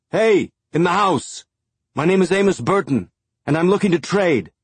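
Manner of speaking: hands cupped, shout to the house. A narrator's reading.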